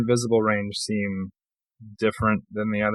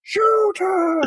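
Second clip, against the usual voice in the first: very high, stretched-out comic shout; mock yelling. Player yelling about Adri a second time, high mock yell. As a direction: holler it high like a movie line. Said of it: "I'm yelling about Adri".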